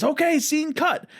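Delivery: high, slow director's call to cut; imitating Spielberg. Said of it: "he says".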